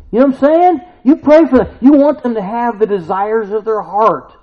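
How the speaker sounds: high, loud fervent preaching; preaching at full force. Real, not acted. Pastor urging mourners to pray hard, preaching loud. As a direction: lift the congregation with fire.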